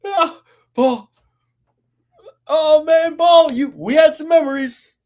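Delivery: mock sentimental.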